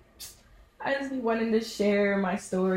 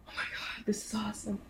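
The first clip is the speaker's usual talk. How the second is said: hushed unvoiced gasp of wonder; awed whisper; 3 semitones higher, 6 dB quieter than usual. Mouth wide open at the weekend, awed whisper. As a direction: whisper it like you can't believe it.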